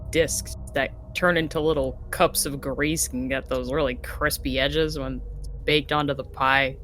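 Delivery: ordinary talk, nothing marked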